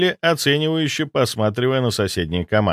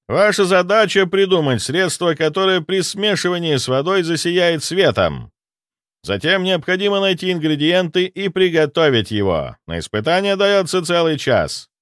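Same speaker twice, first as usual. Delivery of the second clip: торжественное объявление повышенным голосом; обращайся ко всем командам, высоко и раздельно; ведущий турнира; 6 semitones higher than usual. Acted. Ведущий объясняет задание. (торжественный голос ведущего)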